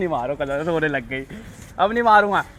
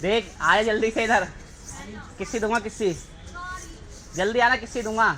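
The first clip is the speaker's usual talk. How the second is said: coaxing call; 7 semitones higher, 4 dB quieter than usual. Real, not acted. Coaxing girlfriend with promised kiss.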